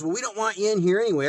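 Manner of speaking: high, fast snippy clerk line; mock employee. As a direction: sneer it like the rude clerk. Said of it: "he goes".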